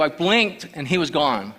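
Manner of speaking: ordinary talk, nothing marked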